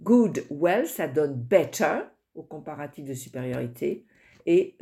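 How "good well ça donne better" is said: ordinary delivery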